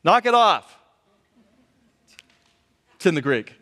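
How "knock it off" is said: loud, higher-pitched command; raised-voice telling-off; sharp rebuke. Acted. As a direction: scold the waves like misbehaving kids. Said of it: "he says to the waves".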